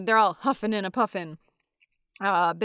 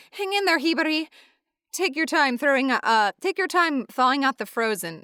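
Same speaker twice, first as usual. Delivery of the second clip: higher-pitched, lightly acted character line; voiced encouragement; 7 semitones higher than usual. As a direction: lightly act out cheering someone on. Voicing a character's encouragement in manga.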